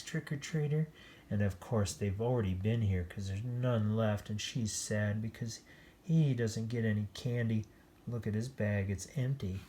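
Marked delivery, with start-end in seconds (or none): none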